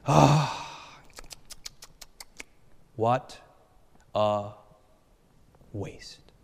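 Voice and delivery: slow, drawn-out disapproval, fading to a hush; imitating Korean elders. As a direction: mimic the community's pitying sigh. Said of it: "the Korean community said".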